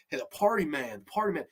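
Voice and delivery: throwaway imitation of Southern speech; horrible Southern accent. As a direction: toss off a bad Southern accent, quietly. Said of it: "trying to get this horrible Southern accent".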